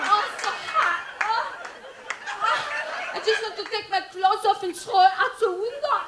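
high, hammed-up French accent with moans; French porn actress. Woman stuck in hot Parisian hotel room, high mock-sultry French accent. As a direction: swoon and moan like a bad actress, French accent. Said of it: "in a Parisian hotel late at night".

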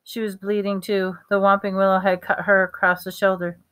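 ordinary delivery

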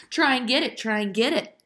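high, teasing call-out; taunting call. A narrator's reading. Aaron taunts Ellen with her bundle, calling out. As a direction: dangle it and taunt. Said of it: "Aaron called out".

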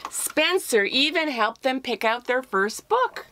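lively read-aloud storytelling voice; story narration. A narrator's reading. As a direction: tell it like reading to children.

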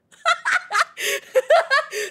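high, loud burst of cackling; helpless laughter. Real, not acted. Streamer cracking up at own joke, helpless laughter. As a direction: lose it laughing.

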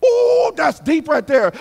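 loud high exclaim; a pleased preacher's holler; excited ooh. Real, not acted. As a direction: marvel out loud at the point. Preacher exclaims at own point.

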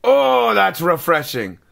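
ordinary talk, nothing marked

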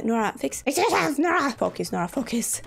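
high, urgent outburst at yourself; frantic self-scolding. Real, not acted. Scolds herself under time pressure.

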